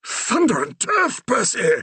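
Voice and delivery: a fussy vicar struck by a dreadful thought; agitated Reverend. A narrator's reading. The Reverend startled, exclaiming to Percy in alarm. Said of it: "the Reverend muttered".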